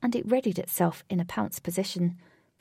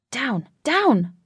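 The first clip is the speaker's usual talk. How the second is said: loud order, higher and harder on the repeat; commanding; 4 semitones higher, 8 dB louder than usual. A narrator's reading. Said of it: "commanded Wraith".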